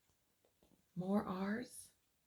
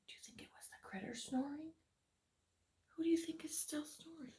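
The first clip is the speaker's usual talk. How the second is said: whisper; 5 semitones higher, 12 dB quieter, faster than usual. Real, not acted. Whispered aside to child listeners.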